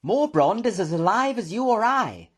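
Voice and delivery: high, loud emphatic professor; excited. A narrator's reading. Professor revealing his brother's news, excited. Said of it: "pacing round the room in excitement".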